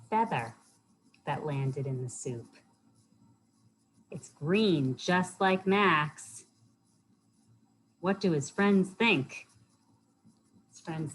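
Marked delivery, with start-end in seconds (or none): none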